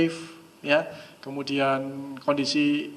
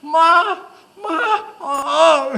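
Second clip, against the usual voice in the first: high, loud wail for mom; hammed-up kid's cry, pitched way up; child yelling; 13 semitones higher, 9 dB louder, much slower than usual. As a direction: imitate a child shrieking for mother. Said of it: "Anak Anda teriak".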